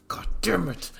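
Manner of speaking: unvoiced annoyed whisper; whispered curse. Endeavor curses at the phone, whispered. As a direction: hiss the curse under the breath.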